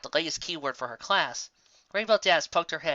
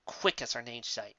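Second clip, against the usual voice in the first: ordinary delivery, nothing marked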